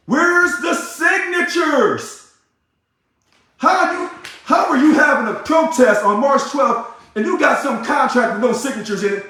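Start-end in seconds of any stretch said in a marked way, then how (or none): 0.1-6.0 drawn-out, high shouted demand; slow it right down and yell it at them; angry shout. Real, not acted. Demanding the signatures from union leaders, high slow shout.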